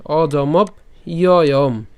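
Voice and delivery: very slow অজমব, then stretched ইয়য়ম; broken-up pronunciation. Shown as a demonstration. Teacher repeats the word in parts, drawn-out অজমব ইয়য়ম. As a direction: lengthen ইয়য়ম after a clearly closed অজমব. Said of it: "ভেঙে উচ্চারণ করতে পারি".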